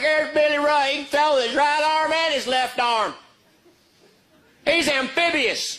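high, proud bragging voice; bragging dad. Retold or imitated. Little League dad bragging on his kid, high and proud. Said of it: "He's like".

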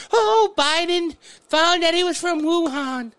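high, drawn-out mocking awe; sarcastic high-voiced wonder; mock amazement. Mocking those crediting Biden.

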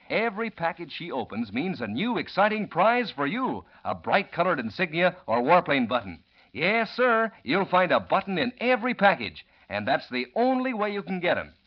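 ordinary delivery

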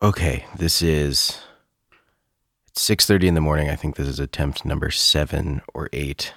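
low, tired early-morning murmur; hushed ghost-hunter. Real, not acted. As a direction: speak low and soft, as if recording a ghost. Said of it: "like you're about to try to record a ghost".

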